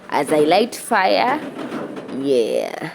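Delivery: ordinary talk, nothing marked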